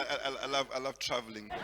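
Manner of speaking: normal talking voice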